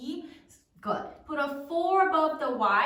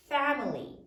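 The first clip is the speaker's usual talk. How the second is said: usual speaking voice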